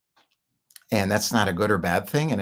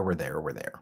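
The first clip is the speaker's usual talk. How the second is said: much softer, lower repeat of "we're there"; fading echo; 5 semitones lower, 9 dB quieter than usual. Real, not acted. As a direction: drop "we're there" to a near murmur. Repeated phrase, fading take.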